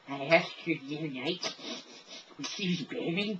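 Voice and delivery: creepy, high, unhinged geek; geeky psycho with an unsettling laugh. Acted. Freaking out coworkers as the psycho geek, creepy high voice. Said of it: "I call it the psycho geek".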